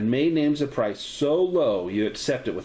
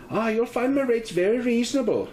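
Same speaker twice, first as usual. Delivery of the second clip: higher Irish landlady voice with a light lilt; 11 semitones higher than usual. Acted. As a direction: greet them as a cheerful Irish landlady. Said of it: "Her voice has a slight Irish lilt".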